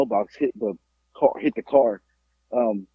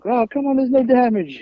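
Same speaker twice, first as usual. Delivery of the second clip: high-pitched mock old person waving it off; imitated old driver; 10 semitones higher than usual. Old driver avoiding losing their license, high mock dismissal. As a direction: brush it off in a raised old-person voice. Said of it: "So they would be like".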